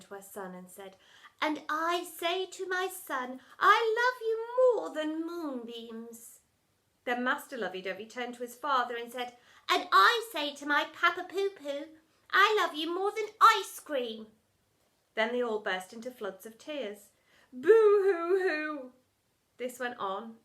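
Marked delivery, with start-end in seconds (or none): 1.4-6.4 higher, slow gushing declaration; higher sugary lovey-dovey mother; soppy mother. A narrator's reading. 9.7-14.3 put-on higher, louder gushing baby voice; higher louder soppy son voice. A narrator's reading. Son declares love. 17.5-18.9 loud slow hammed-up boo-hoo; louder sung mock sob; mock sobbing. A narrator's reading. They burst into tears.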